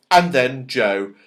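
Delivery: ordinary delivery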